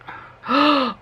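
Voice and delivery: sharp surprised gasp. Real, not acted. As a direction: gasp on seeing the colour. Spots the plummy colour.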